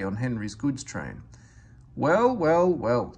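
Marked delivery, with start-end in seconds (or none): 1.9-3.1 chuckled. A narrator's reading. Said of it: "chuckled Henry".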